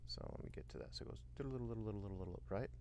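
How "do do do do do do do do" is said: soft, trilling flute imitation; imitated swirly flutes. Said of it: "the swirly sounds of the flutes".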